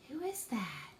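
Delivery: hushed unvoiced question; whisper. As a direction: whisper it like a secret to the kids. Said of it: "whispering to children about a figure".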